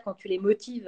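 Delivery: ordinary talk, nothing marked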